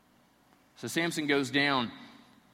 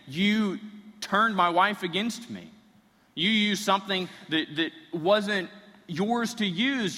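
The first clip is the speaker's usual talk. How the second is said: accusing; 6 semitones higher, 4 dB louder, slower than usual. Acted. Samson accuses the Philistines.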